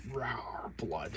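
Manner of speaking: breathy little mock wolf growl; mimicking a wolf ripping apart animals, softly growled. Imitating wolf attack.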